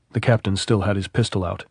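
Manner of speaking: usual speaking voice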